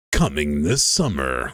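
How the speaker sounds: deep, dramatic trailer narrator voice; movie trailer voice. Shown as a demonstration. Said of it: "when I really summoned the deep side of myself".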